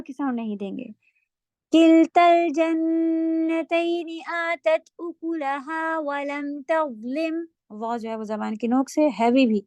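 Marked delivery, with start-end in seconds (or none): 1.7-7.4 very slow Quranic recitation. Shown as a demonstration. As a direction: recite it slowly and evenly. Models the verse recitation. (بہت آہستہ تلاوت)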